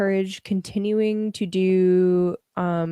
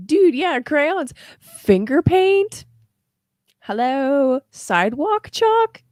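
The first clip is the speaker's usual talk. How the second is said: higher and louder, slow list; excited; 7 semitones higher, 6 dB louder, slower than usual. Real, not acted. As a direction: gush over each craft item.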